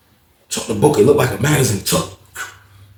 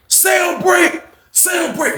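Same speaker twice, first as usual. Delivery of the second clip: much higher shouted call; guard's shout; slower than usual. Retold or imitated. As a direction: bark the call across the unit. Guard announces break.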